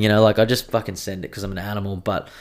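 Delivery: ordinary delivery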